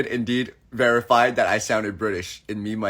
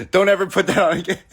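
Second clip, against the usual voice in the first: laughing protest; 5 semitones higher, 4 dB louder, faster than usual. Real, not acted. Laughing mock complaint.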